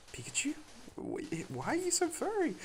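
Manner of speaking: high-pitched disbelieving squeal; shocked surprise. Retold or imitated. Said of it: "I was like".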